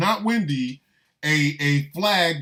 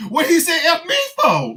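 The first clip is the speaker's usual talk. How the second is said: high, loud offended outburst through laughter; mock indignant; 13 semitones higher, 7 dB louder than usual. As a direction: act out being insulted out of nowhere, cracking up. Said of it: "Rosie was like".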